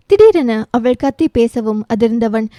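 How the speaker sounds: normal talking voice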